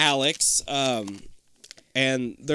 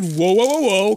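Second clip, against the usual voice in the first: mildly louder imitated whoa; mock alarmed whoa; 4 semitones higher, 6 dB louder, faster than usual. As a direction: echo his startled whoas, lightly. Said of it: "I thought he said".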